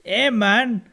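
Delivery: hammed-up Mexican accent, playful; mock Mexican accent. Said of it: "I don't know what accent that is".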